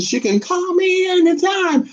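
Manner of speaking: high, sing-song flirty invite; flirty tease. Real, not acted. Host flirting with a viewer in the chat, high sing-song. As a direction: purr it like a come-on. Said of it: "Well, he can call me anytime".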